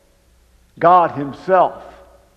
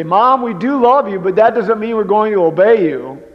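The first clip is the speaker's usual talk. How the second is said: put-on cheeky kids' reply; 6 semitones higher, 6 dB louder than usual. Kids answering mom, retold.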